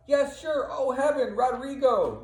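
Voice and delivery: high, loud hammed-up anguish; theatrical false mourning, pitched high and loud; feigned grief. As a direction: fake a shocked lament for his friend. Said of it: "He pretends like he doesn't know".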